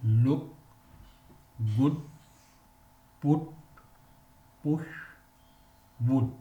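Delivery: "Look, good, put, push, wood" each with a short oo, spaced apart; short oo drill. Shown as a demonstration. Teacher modelling the short oo for students, careful drill. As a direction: say each word alone with a short oo vowel. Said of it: "pronounce these words".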